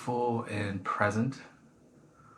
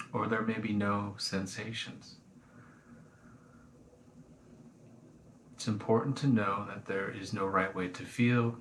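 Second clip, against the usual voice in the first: guided meditation. Acted. Teacher guiding listeners through a body scan, calm meditation voice. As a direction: ease them gently into the body scan.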